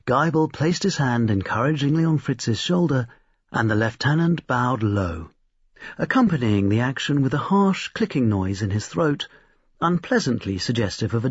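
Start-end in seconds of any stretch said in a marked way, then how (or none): none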